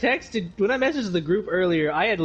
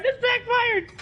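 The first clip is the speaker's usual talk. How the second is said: much higher panicked squeal; being chased; slower than usual. Acted. Chased survivor, repeated higher.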